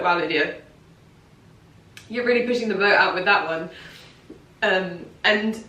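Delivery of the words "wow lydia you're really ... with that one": lower, fast wry self-tease; inner teasing voice. Retold or imitated. Her inner voice teasing her about the bold dress, wry. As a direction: rib yourself, deadpan. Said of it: "in my head, I was like".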